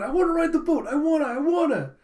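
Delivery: high, loud whiny insistence; mock tantrum, pitch shooting way up; childish demand. Child begging parents for the boat ride, mock tantrum.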